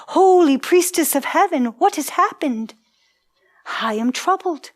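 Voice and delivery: dramatic, grieved appeal; troubled, grieving outcry. A narrator's reading. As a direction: lament a daughter's fate, swelling on her titles. Troubled parent learns daughter's fate.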